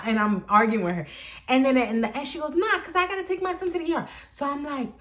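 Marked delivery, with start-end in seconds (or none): 2.6-4.1 high, fast mocking excuse; whining mother. Storyteller mimics the mother citing the ER, high fast mock whine. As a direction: mimic her hurriedly up high.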